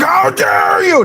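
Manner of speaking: high, slow mocking shriek of outrage. Mocking The View hosts, a high slow outraged squeal. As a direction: drag it out in a shrill mock scandalized squeal. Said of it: "they're like".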